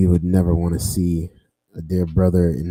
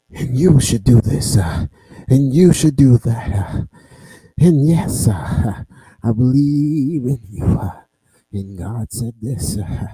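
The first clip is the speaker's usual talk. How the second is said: mock preacher, louder and higher, punching each phrase; preacher impression; 4 semitones higher, 5 dB louder than usual. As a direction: preach it up with an "uh" after each line. Mimics a cheek-clapping pastor preaching.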